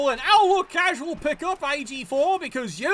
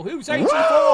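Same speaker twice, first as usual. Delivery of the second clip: high loud excited shout; excited high yell; 10 semitones higher, 6 dB louder than usual. Real, not acted. Streamer whooping as the dice come up. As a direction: cheer it out, thrilled.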